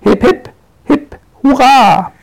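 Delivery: high, loud hip hip hurray; girl leading a cheer. Acted. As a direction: lead the cheer. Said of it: "ruft Fuchsia".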